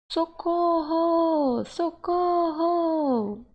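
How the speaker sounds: high, very long repeated wail for help; demonstrated cry that goes on and on; constant pleading for help. Shown as a demonstration. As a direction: keep calling out, each vowel held long. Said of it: "está constantemente, né, pedindo ali".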